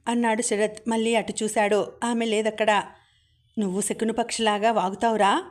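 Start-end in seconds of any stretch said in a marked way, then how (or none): none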